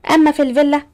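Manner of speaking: usual speaking voice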